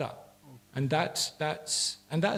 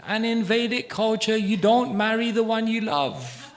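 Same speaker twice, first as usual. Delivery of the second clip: higher, louder priestly announcement; priest on loudspeaker; 7 semitones higher, 4 dB louder than usual. Retold or imitated. Speaker imitating a priest heard from a wedding marquee, raised announcing voice. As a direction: proclaim it like a priest over a loudspeaker. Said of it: "the priest on the loudspeaker".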